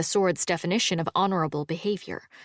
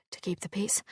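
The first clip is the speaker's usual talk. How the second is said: whispering; 9 dB quieter than usual. A narrator's reading. Whispered talk while hiding.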